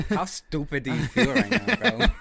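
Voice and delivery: teasing through laughter; laughing tease. Real, not acted. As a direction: laugh while mocking him. Teasing friend over mistake.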